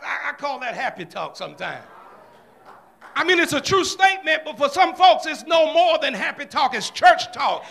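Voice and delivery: ordinary delivery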